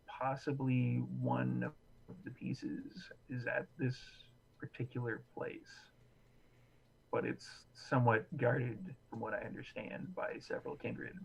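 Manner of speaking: hushed, secretive whisper. Acted. NPC shares secret rumor.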